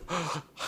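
sharp mock intake of breath; imitated gasp of amazement. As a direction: gasp like an astonished congregation.

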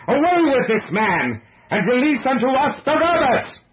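furious crowd yell, very high; crying out. A narrator's reading. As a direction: roar the demand at full voice. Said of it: "they cried out again".

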